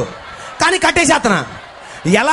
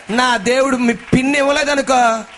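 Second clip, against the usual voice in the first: ordinary talk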